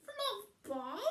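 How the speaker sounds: high disbelieving witch; disbelieving high rise; high incredulous witch; Winnie voice. A narrator's reading.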